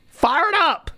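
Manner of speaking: high burst of excitement; fired up. Real, not acted. Streamer explaining his busy day, a high excited burst. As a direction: shout it like you're pumped. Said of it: "That's why I'm fired up".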